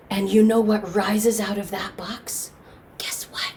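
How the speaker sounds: near-whispered teasing question; hushed suspense. A narrator's reading. Storyteller asking what rises out of the box, hushed suspense. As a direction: drop to a secretive hush.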